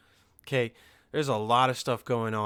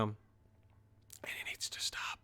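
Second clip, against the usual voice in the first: whisper; 3 semitones lower, 14 dB quieter, much slower than usual. Real, not acted.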